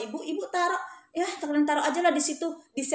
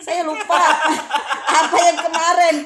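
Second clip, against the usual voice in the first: loud, laughing retelling; 4 semitones higher, 11 dB louder than usual. Real, not acted. Teacher amused at having forgotten, real laughing. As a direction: talk through loud laughter.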